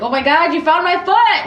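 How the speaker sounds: high, overjoyed mock exclamation; mock excited cry. Imagined survivor to the searchers who found him, mock excited. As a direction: cry it out in pretend amazement, pitch climbing.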